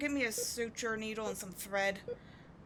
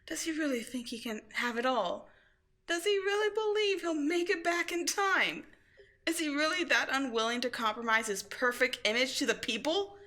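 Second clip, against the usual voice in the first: bitter anger; 6 semitones higher, 7 dB louder than usual. Acted. Villain bitterly questioning hero.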